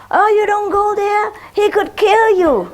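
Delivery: high, cooing lady talking to her dog; mock sweet dog-owner voice. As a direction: coo at the little dog like the neighbor.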